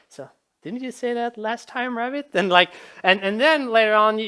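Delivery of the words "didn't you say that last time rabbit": higher-pitched mock suspicion; puzzled player. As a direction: question the rabbit, acting newly doubtful. Player doubts the repeated line.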